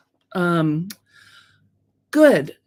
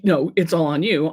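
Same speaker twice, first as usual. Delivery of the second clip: bad-news delivery. Coach tells viewers the downside of having control, sober bad-news delivery. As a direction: give it as the bad news, in your usual pitch. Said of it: "sort of a good news, bad news".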